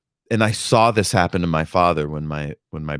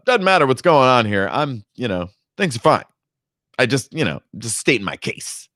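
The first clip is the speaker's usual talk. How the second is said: louder and fast, a hammed-up smug son; mock stuffed shirt; 6 semitones higher, 3 dB louder than usual. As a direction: brush off the worry like a pompous prig. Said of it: "stuffed shirt, which is basically".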